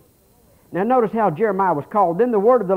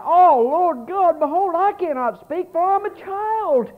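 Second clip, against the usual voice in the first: high, slow pleading protest; frightened prophet; 14 semitones higher, 5 dB louder, slower than usual. Acted. Jeremiah pleading with God that he is only a child, high and afraid. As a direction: shrink back, begging God to pick someone else. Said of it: "it scared him to death".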